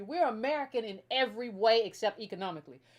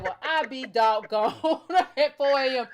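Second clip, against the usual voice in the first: laughing through a louder mock lament; mock dismay; 6 dB louder, slower than usual. Host imagines an American abroad finding no food, laughing dismay. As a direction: play the stranded late-night eater, cracking up. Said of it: "and be like".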